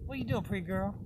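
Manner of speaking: high, soft cooing at a cat. Real, not acted. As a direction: coo to a pretty kitty.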